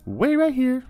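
high, bright-eyed waiter voice pitched well up; shop staff voice. Acted. Takeaway staff apologizing to the customer, in a high role voice. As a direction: play the friendly staff member, pitched high.

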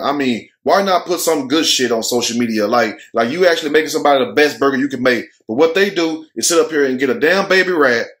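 ordinary talk, nothing marked

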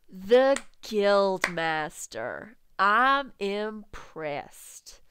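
very slow, drawn-out delivery; slow admiration. Acted. Onlooker applauding Reed's catch, slow drawn-out praise. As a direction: stretch every word for effect.

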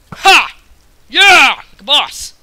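loud, high victory shout; triumphant yell. Real, not acted. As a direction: celebrate the kill at full voice. Celebrating a kill.

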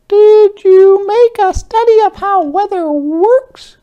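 very high, loud squeaky squirrel; Sunny Squirrel asking Jeffrey, very high squeak. Acted. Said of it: "asked Sunny Squirrel".

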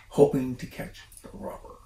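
plain reading voice

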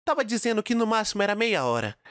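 ordinary delivery, nothing marked

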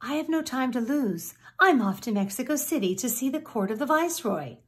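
a busy rooster with no time to lose, fast; Half Chicken, eager. Acted. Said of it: "he answered".

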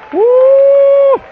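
high loud woo of ecstasy; joyful holler. Real, not acted. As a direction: let out a real shout of joy. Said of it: "makes me want to holler".

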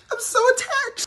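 very high, gushing squeal; emotional squeal. Acted. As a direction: gush like a weepy mom-to-be. Pregnant mom gets emotional.

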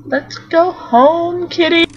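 high, slow call to kitty; coaxing call home; calling the cat. Real, not acted. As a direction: sing it out to the cat.

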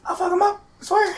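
mock threat. Joking high-pitched threats.

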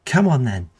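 loud high goading yell; taunting shout. A narrator's reading. Goading the beaten youth to fight, taunting shout. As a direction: bait them into fighting.

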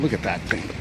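usual speaking voice

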